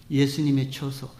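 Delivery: ordinary talk, nothing marked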